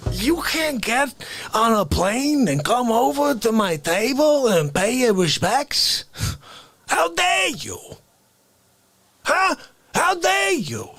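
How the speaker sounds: put-on New York accent, shouting, pitched very high; Face character shouting. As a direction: explode with indignation. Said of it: "Face appears".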